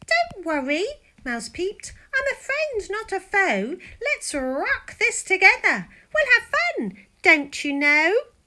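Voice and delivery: high, friendly and reassuring; cheerful mouse voice. Acted. The mouse reassures the scared lion, high and friendly. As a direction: cheer him up, high and bright.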